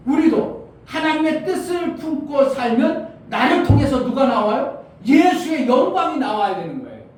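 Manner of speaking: high, forceful sermon delivery; impassioned preaching. Real, not acted. Preacher urging the congregation to show Jesus' glory, impassioned. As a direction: drive each point home to the congregation.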